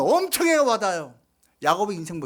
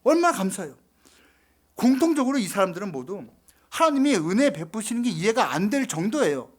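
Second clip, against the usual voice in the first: ordinary talk, nothing marked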